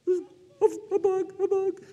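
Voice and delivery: high imitated child's squeal; mock fright. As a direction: squeal like a nervous girl.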